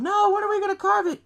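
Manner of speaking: put-on high-pitched kid voice; mock whiny-kid demand; pestering child. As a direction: insist like an impatient little boy. Retold son pestering his father.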